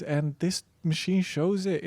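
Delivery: ordinary delivery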